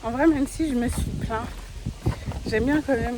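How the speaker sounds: talking outdoors with wind noise on the mic. Real, not acted. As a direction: wind buffeting an unshielded mic. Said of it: "je sais même pas si vous allez m'entendre avec le vent parce que j'avais pas mis le micro".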